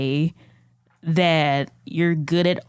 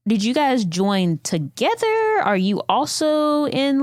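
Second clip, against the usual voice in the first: high, drawn-out, probing questions; nosy Daphne; 7 semitones higher, slower than usual. Acted. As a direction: stretch the questions out in a high, curious voice. Player voices Daphne probing a stranger.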